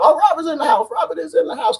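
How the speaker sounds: high announcer-style welcome; hype shout-out. Real, not acted. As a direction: announce him like a hype man. Shouting out a viewer's arrival.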